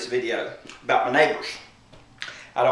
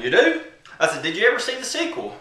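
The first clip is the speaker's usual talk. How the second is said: high-pitched leading question; feigned interest; 10 semitones higher than usual. Retold or imitated. Storyteller setting up the neighbor, high feigned curiosity. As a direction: lure him in with raised, rising questions. Baiting neighbor before a punch.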